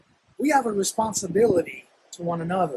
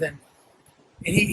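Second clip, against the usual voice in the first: ordinary talk, nothing marked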